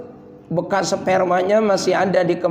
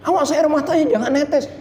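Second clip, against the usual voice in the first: high mock nagging, half laughing; fussy scolder; 10 semitones higher, faster than usual. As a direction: mimic the busybody forbidding tears. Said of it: "Jangan ngada-ngadain hukum". (meniru orang yang melarang menangis, nada tinggi)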